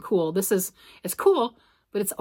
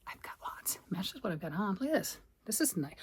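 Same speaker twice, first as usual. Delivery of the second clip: whisper; 11 dB quieter than usual. Real, not acted. Muttering over found yarn.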